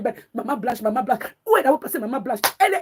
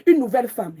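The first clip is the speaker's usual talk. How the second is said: ordinary delivery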